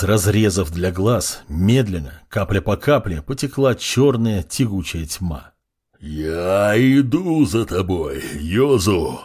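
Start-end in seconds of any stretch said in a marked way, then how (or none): 6.0-9.2 roaring. A narrator's reading. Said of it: "проревел костяной лорд".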